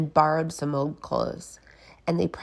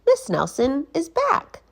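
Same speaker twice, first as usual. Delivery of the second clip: put-on kids voice; 9 semitones higher, 4 dB louder than usual. Kids voice on the phone.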